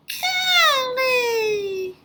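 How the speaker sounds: high, slow, squeaky puppet greeting; a cheerful little puppet says hello; high cartoon puppet voice calling out; puppet Leo voice. Puppet corrected.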